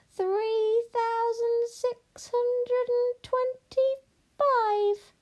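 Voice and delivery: very high, very slow witch chant; witch counting. Acted. Witch ignores princess to count gold, droning. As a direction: intone the count in a long high drawl.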